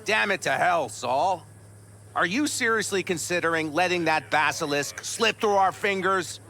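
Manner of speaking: exasperated outburst. Acted. Clan member pleads with Saul not to lose the basilisk, exasperated. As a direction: argue heatedly, pitch climbing in frustration. Heated argument with the leader.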